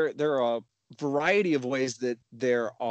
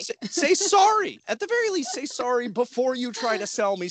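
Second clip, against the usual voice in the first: exasperated; 9 semitones higher than usual. Real, not acted.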